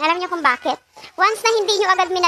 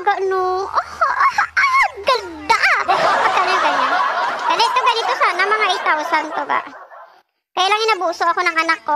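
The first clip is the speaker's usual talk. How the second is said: ordinary delivery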